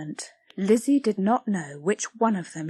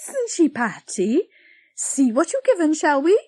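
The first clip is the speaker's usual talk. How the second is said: higher prying busybody; nose into the bag; Blodwin, nosy; 8 semitones higher, 5 dB louder than usual. Acted.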